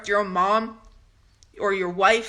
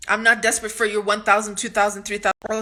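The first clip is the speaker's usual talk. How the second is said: ordinary delivery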